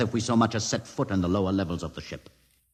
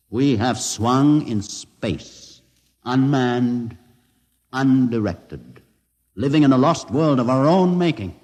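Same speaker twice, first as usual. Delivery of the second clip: swelling lament; 4 semitones higher, 5 dB louder, slower than usual. Acted. Solemn lament over the lost ship.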